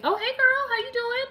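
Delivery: high, bright mock surprise greeting; excited greeting. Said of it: "and I'm like".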